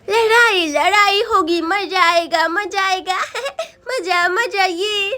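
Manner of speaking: put-on giggling, childish glee; gleeful. Onlooker expects a fun fight, giggling with glee. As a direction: chant it with delighted laughs.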